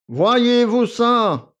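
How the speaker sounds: loud, high, drawn-out scoff; mock her claim slowly; haughty, stretched exclamation; scornful. A narrator's reading. Valet scoffs at the girl.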